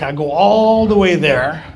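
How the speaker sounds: "all" stretched long and loud; drawn-out ALL. Retold or imitated. Griping to the meeting about the trip, drawn-out "all". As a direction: lean hard and long on "all".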